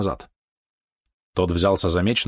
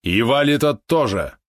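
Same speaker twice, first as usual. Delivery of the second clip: slow, raised decree; stern command; 6 semitones higher, slower than usual. Acted. Murmanin orders the traitors impaled, slow and commanding. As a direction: pass the sentence with no room for appeal. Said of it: "повелел Мурманин".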